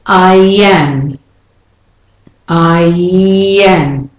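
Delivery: I am drawn out as I-yyyam; stretched y glide. Shown as a demonstration. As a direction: slowly slide through a y in I am. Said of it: "you've got those two vowels".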